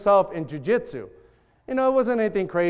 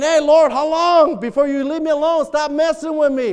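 higher, louder mock complaint to God; exasperated protest; 5 semitones higher, 4 dB louder than usual. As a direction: plead in fed-up protest. Acts out Job protesting to God.

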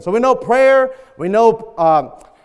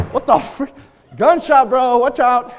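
mock alarm; 4 semitones higher than usual. Acts out reaction to police knock.

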